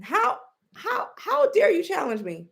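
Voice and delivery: high, stammering mock indignation; Donald Trump. Imitating the president affronted by California's challenge, mad. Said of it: "he's mad".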